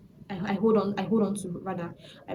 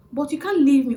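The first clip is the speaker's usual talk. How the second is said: loud, high desperate plea; stranded girl pleading with the driver, loud and high; 7 semitones higher, 9 dB louder than usual. Retold or imitated. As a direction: beg him not to leave.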